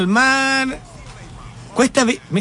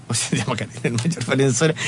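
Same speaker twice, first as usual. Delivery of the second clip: lower, softer, laughing through it; laughing correction; 7 semitones lower, 4 dB quieter, faster than usual. Real, not acted. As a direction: correct yourself while laughing. Laughs at own slip. (corrigiéndose entre risas)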